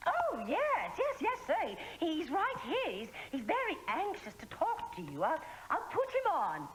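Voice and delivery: fake Swedish accent. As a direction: pose as a Swedish camp counsellor on the phone. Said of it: "You sound funny with that accent".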